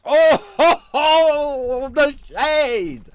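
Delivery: high, loud, laughing whoop; scandalized, drawn-out cheer; gleeful outburst. Real, not acted. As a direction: crow with delight at a savage burn. Reacting to a cutting super chat.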